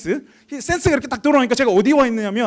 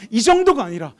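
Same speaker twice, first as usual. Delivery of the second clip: ordinary delivery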